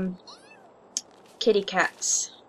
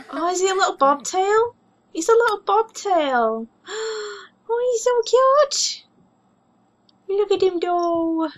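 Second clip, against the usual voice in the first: cooing at kitten; 9 semitones higher than usual. Real, not acted. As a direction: gush over the little bobtail.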